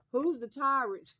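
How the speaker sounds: a streamer loudly calls out whoever is the Taurus; teasing call-out. Real, not acted. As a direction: slowly sing out the question to the Taurus. Calling out the Taurus.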